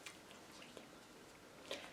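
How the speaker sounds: very faint whisper; barely audible whisper. Real, not acted. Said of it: "I may be talking a little low".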